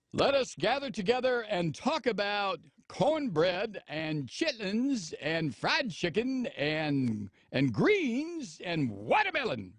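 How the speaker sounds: slow, drawn-out hammed-up oration with swooping pitch; mock assembly speaker. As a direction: address the assembly like a mock preacher. Parodies officials addressing the campus assembly.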